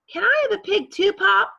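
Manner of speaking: put-on high, loud, fast kid's plea; eager boy. Avery asking father for a pig, high fast plea. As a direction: beg it quick like an excited boy.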